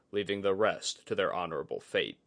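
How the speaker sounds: usual speaking voice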